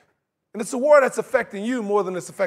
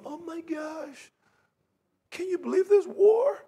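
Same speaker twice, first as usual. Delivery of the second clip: high-pitched mock disbelief; mock shock; 7 semitones higher, 3 dB quieter, slower than usual. Preacher mimicking Americans shocked by war, mock disbelief. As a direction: play it scandalized, drawn out and high.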